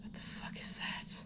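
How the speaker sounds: hushed, breathless whisper; alarmed whisper. Real, not acted. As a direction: whisper it warily at a strange noise. Startled by sound in game.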